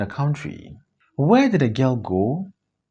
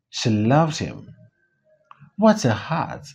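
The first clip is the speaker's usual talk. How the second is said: ordinary talk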